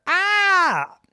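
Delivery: very high, loud startled cry; 吓得尖叫一声; startled scream. A narrator's reading. Iron thrown at her.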